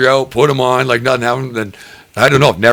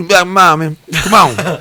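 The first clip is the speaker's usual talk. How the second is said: higher-pitched, garbled mock pleading; imitating unintelligible kids; 8 semitones higher, slower than usual. Kids surrounding him at the gas station, imitated garbled pestering. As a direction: run it together like kids nobody can understand. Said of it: "I could not understand what the fuck they were saying".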